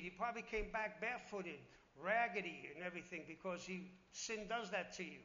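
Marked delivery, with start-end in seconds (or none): none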